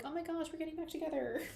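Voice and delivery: high, laughing, gushing reunion; giddy reunion squeal. Pretend. Pretending money hugs her, high laughing squeal. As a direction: squeal it like meeting a long-lost love. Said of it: "and I'll be like".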